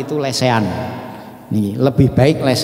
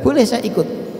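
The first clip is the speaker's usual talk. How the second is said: asking to join; 9 semitones higher than usual. Retold or imitated.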